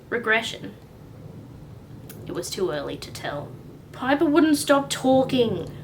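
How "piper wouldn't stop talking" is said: put-on high, loud sulky boy; grumpy boy. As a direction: sulk it out. Frank's grumpy reply.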